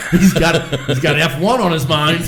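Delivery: cracking up mid-sentence; laughing. Real, not acted. Friend laughs at the Mid-Eastern slip, laughing loud.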